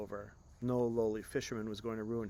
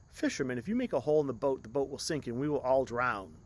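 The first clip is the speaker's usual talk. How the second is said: reasoning aloud; 3 semitones higher, 8 dB louder than usual. Acted. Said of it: "the prince reasoned aloud".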